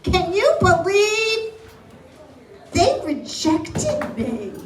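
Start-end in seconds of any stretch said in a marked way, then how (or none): none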